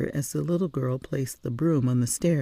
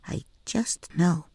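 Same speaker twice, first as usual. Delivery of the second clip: a hint of a little girl, sure of herself; lightly voiced little girl; 5 semitones higher, slower than usual. A narrator's reading. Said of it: "with total conviction".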